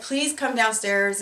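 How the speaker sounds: loud, slow call upstairs; mother calling her children down from the kitchen. Retold or imitated. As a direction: call up the stairs to the children, pleading.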